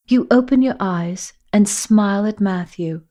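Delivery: ordinary delivery, nothing marked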